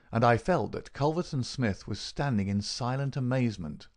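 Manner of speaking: ordinary delivery, nothing marked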